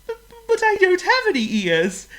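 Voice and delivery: a tiny bird sighing for the sky; wistful little bird voice; little bird wishing. Acted. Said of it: "said Chirp".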